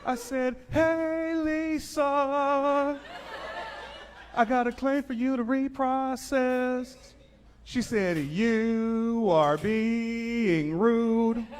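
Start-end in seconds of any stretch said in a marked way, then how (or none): 0.7-7.0 high, slow copying voice; mock slow voice. Rep mimics slow agent. 8.2-11.5 very slow, high, drawn-out agent; slow slurred voice. Retold or imitated. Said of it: "Why are you talking like that?".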